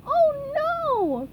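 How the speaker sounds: very high, scared cry; frightened cry. A narrator's reading. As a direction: squeal in terror. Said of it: "cried SpongeBob".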